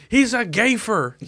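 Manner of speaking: put-on high, slow kid's jeer; mock playground taunt. Kid insulting wrestling partner, high mock jeer.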